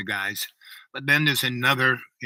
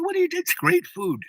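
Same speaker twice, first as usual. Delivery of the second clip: surprised. Retold or imitated. Surprised question to the chief.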